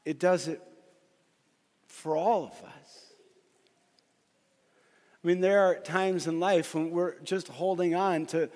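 rising preaching. Acted. Pastor to the congregation, rising preaching cry. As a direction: build the sermon to full force. Preacher builds to full force.

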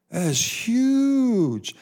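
high, slow, stretched-out amazement; drawn-out marvel. Acted. Preacher wows his listeners, stretching the words up high. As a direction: pastor savouring his point to the congregation, drawn out high and slow.

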